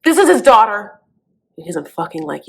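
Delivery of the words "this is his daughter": loud, higher burst of fatherly outrage; mock-angry protest. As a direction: play the indignant dad, hammed-up. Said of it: "He's a very angry man".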